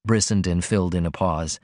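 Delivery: ordinary delivery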